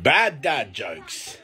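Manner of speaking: drawn-out deadpan title call; slow title announce. Acted. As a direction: announce it slowly and flat.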